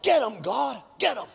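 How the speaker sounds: eager, punched urging; egging on. Acted. Sinner urges God to punish others, egging on. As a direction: cheer on someone else's punishment.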